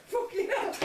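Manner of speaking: high, loud laughing curse; shocked cry cracking into a laugh; laughing exclamation. Retold or imitated. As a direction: blurt it out through laughter. Shocked at the cat dying.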